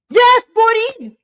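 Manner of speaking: high, loud, slow cheer; excited cheer. Real, not acted. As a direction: scream yes in triumph. Cheering the partner's win.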